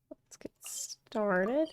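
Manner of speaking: slow, breathy near-whisper; hushed. Pretend. Streamer warming up to start the quest, hushed. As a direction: draw it out very softly, almost voiceless.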